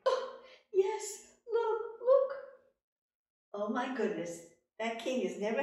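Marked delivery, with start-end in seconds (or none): 0.1-2.3 very high, loud pleading; frantic young woman. Acted. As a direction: urge him to look. Rose shows the straw.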